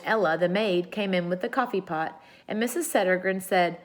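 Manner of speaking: plain reading voice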